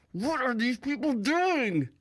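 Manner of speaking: wide-eyed whisper-hiss at her husband; imitating wife talking through her teeth; through gritted teeth. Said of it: "talk to you through your teeth".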